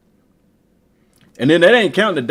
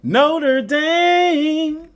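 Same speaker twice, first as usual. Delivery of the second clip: high, drawn-out Notre Dame; sing-song game callout; game announcer voice; 13 semitones higher than usual. Acted. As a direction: call it like the game's announcer. Game voice reads the name.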